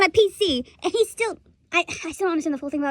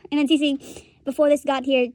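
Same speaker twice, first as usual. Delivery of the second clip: ordinary delivery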